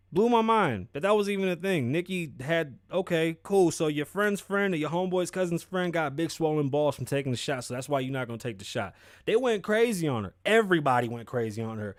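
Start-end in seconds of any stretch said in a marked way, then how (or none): none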